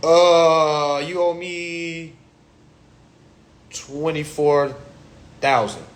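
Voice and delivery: slow, deadpan drawn-out figure; plug naming the debt. Retold or imitated. Plug telling his runner the huge debt, slow deadpan voice. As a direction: drag out the number for effect. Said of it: "And I'd be like".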